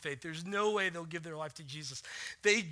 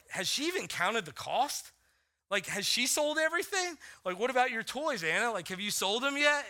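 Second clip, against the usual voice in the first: higher, pushy, half-joking grilling; mock interrogation; 5 semitones higher, 3 dB louder than usual. Father grilling his seven-year-old about her toys, mock stern. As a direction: quiz her like a skeptical examiner. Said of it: "I'm like".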